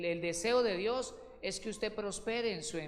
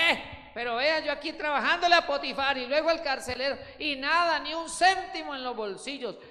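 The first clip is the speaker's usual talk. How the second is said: loud, higher, laughing grumble; mock complaint; 7 semitones higher, 8 dB louder than usual. José grumbling about working for nothing, loud laughing complaint. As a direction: complain with a chuckle about unpaid work. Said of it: "Y tal vez José decía".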